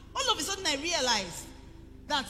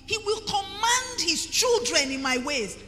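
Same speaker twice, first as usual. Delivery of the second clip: preached proclamation; 6 semitones higher, 5 dB louder, slower than usual. Acted. Preaching God's words.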